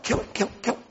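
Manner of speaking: hushed urgent whisper; retold whispered urging. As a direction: whisper it like men egging him on in the dark. Men urging David in cave.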